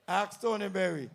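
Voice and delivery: ordinary talk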